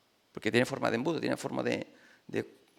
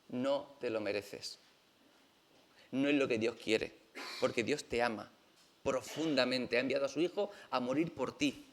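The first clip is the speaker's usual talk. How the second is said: soft, low and sincere; quiet, heartfelt pastoral reassurance; tender consolation; 4 semitones lower, 8 dB quieter than usual. Real, not acted. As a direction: console them gently, softer and lower. Pastor consoles the hurt listeners.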